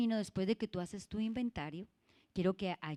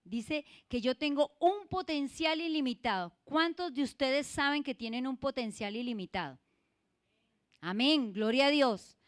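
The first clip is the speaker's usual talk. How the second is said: higher and louder, rousing the church; full-force preaching cry; fervent preaching; 5 semitones higher, 7 dB louder than usual. Real, not acted. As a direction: preach it with rising fervor.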